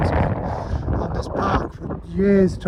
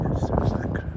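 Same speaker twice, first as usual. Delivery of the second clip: ordinary talk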